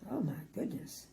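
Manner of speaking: soft unvoiced aside; whisper. Real, not acted. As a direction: whisper it to the listener.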